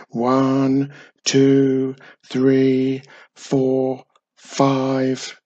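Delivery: loud, even count on one level note; march out the numbers, evenly spaced; counting aloud. A narrator's reading. Wizard counting his steps across sand, loud level count.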